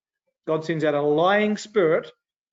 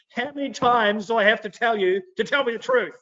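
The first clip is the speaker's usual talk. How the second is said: higher-pitched, put-on fed-up king; a touch of indignant complaint; mock exasperation; 6 semitones higher than usual. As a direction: play a mildly exasperated ruler. Ahab acted, exasperated with Micaiah.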